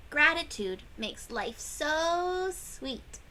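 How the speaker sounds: plain reading voice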